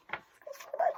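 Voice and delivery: unvoiced whisper of the chant. Teacher leading kids through the mud, in a whisper. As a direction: breathe the words, no voice.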